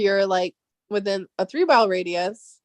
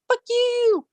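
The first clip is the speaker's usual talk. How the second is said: high-pitched mock defiant outburst; cartoonishly high taunt; mock defiance; 12 semitones higher than usual. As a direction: imitate a petulant comeback, pitched way up. Mock quarrel between countries, acted.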